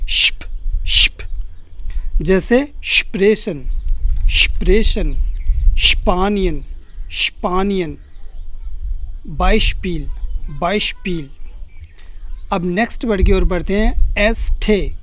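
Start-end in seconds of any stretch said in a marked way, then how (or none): 0.1-1.2 श्प as hushed "sh" closing on "p"; "shp" sound. Shown as a demonstration. Teacher shows how German sp sounds, श्प as "shp". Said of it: "इसका sound क्या निकलता है". 2.8-11.3 स्प्रेशन, स्पानियन, बाइशपील with "shp" for sp; German sp as "shp". Shown as a demonstration. Teacher drills sp examples, "shp" in स्प्रेशन, स्पानियन, बाइशपील. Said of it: "इसका sound क्या निकलता है".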